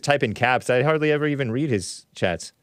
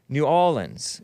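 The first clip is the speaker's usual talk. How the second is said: ordinary delivery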